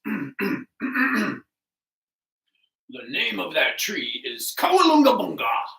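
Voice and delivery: loud, grand proclamation; bluffing lion. Acted. Lion bluffs before the waiting animals, loud and pompous. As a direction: boom out the made-up word as if certain.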